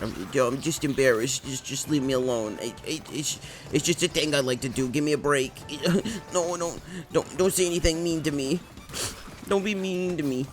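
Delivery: embarrassed whine. Retold or imitated. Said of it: "I'd be like".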